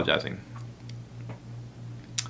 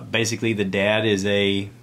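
normal talking voice

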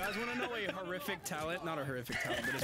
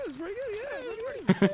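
squeaky high voice; 5 dB louder than usual. Acted. Repeating the line with rising pitch.

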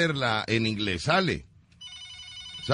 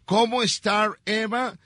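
mock English; 7 semitones higher than usual. Shown as a demonstration. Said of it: "Si yo te digo".

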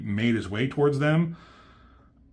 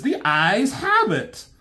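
high, slow smug announcement; high, drawn-out gloating verdict; Starscream voice; 10 semitones higher, slower than usual. Retold or imitated. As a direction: savour the result like a sly schemer. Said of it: "Starscream says".

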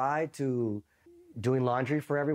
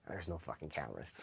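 low, much softer aside; muted retort; 7 semitones lower, 14 dB quieter than usual. Retold or imitated. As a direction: drop right down for the imitated comeback. Interrogator answers the protest.

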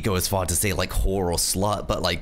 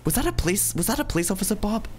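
high-pitched worried asking; alarmed question; 10 semitones higher than usual. Real, not acted. Driver asking Bob about a police officer, high and worried. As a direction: ask anxiously, voice jumping up high. Fears police saw red light.

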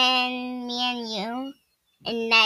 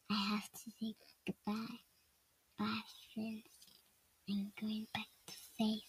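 very soft, barely voiced murmur; whispered pretend reading; 3 semitones lower, 17 dB quieter, faster than usual. Child saying goodbye to friends, whispered.

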